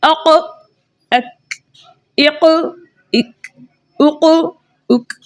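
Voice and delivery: अक इक उक drilled in pairs; letter sounds demo. Shown as a demonstration. Teacher drilling qaf and kaf for students. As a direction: sound out each syllable. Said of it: "अब ये है कॉफ और काफ".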